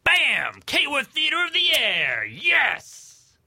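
big excited radio announcer. Acted. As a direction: boom it out like a show host. Announcer show opener.

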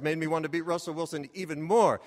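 ordinary talk

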